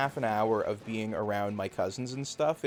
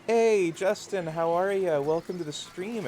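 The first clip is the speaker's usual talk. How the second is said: louder, higher rural Canadian greeting, drawn out; rural Canadian accent; 5 semitones higher, 3 dB louder than usual. Shown as a demonstration. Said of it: "it would probably be very off-putting".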